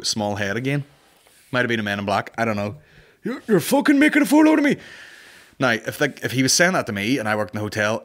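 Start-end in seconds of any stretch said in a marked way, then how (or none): 3.2-4.8 shouting traveller. Retold or imitated. Repeats traveller's line.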